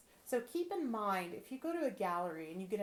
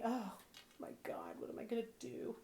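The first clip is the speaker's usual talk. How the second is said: hushed, softer whispered panic; mock despair whisper; 2 semitones higher, 6 dB quieter than usual. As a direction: whisper it like a tragedy.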